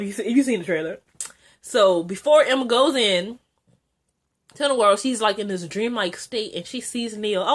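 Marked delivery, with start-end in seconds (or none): none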